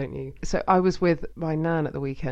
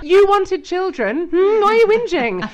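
high, loud teasing scold; mock scolding; 12 semitones higher, 9 dB louder than usual. Host teasing male listeners, high mock scolding. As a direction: tease the moaning dads. Mocking a complaining man.

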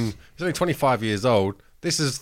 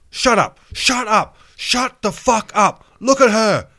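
loud, much higher shouting; angry nurse yelling; 12 semitones higher, 7 dB louder, slower than usual. Retold or imitated. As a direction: yell at the crying kid to shut up. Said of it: "the nurse was like".

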